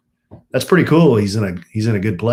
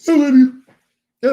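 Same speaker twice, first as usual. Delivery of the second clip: cooing; 7 dB louder than usual. Real, not acted. Kissing child, cooing affection back.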